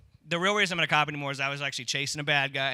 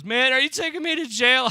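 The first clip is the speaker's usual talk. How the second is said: higher, louder whiny plea from the ground; pitiful plea; 9 semitones higher, 8 dB louder than usual. Retold or imitated. Sick man on all fours begging the cop, whiny plea. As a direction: beg the cop not to arrest you. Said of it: "he goes".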